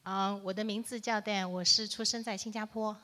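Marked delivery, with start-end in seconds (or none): none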